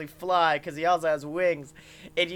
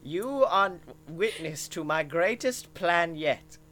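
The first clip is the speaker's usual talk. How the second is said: villain Herzog voice. Acted. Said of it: "And he goes".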